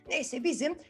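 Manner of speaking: ordinary delivery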